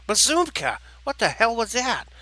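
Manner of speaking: high, loud outburst of alarm; startled exclamation. Acted. Turkey knocked down by the crash, startled exclamation. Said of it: "Jerky exclaimed".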